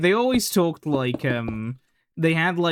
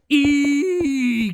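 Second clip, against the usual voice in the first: high, loud hammed-up yell of the letter; mock scream; 11 semitones higher, 4 dB louder than usual. Screaming at the giant E outside, hammed-up high yell. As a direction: shriek it like you've spotted the giant E. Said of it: "they were just also screaming".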